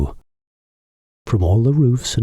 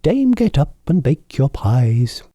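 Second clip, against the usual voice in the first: crowing cocks; 7 semitones higher than usual. A narrator's reading. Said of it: "First and loudest the cocks cried".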